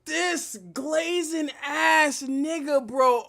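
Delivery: slow, dragged out yell; annoyed shout. Real, not acted. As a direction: drag each word out in disgust. Annoyed at fawning prosecutor.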